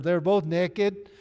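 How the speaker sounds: playful Arkansas pronunciation of "naked" with a short e; Arkansas accent. Shown as a demonstration. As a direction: say "naked" as Arkansas "nekkid", drawn out. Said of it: "Arkansas naked".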